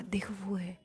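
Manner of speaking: whispering from the village man to the crowd; hushed, breathy whisper pointing him out. Retold or imitated. Villager whispers to his neighbour about the man. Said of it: "उसने कहा".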